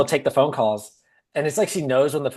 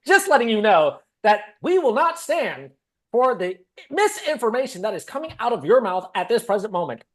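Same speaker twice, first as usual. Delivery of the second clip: anchor voice; 8 semitones higher, 3 dB louder than usual. Retold or imitated. Woman scolding her husband, in her high anchor voice. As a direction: read it like a live bulletin. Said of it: "in your anchor voice".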